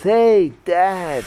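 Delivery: loud, high greeting to Dad; mock-excited little kid. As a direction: call out like an eager child. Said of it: "the kid's so excited and says".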